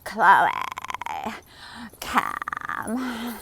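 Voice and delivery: loud, drawn-out mock Kardashian names; put-on reality-star whine; rich-people vocal fry. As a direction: stretch the names in a creaky socialite parody. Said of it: "This is how the rich people talk".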